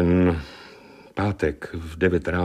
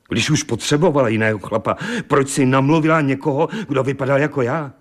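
higher-pitched, pained protest; indignant bewilderment; 7 semitones higher, 4 dB louder than usual. Acted. Betrayed husband asks why she chose his lookalike, indignant. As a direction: build to an incredulous, hurt question.

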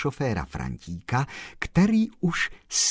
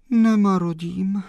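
sighed out; 11 semitones higher, 8 dB louder than usual. A narrator's reading. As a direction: sigh the answer plaintively. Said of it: "zavzdychla slečna Mařenka".

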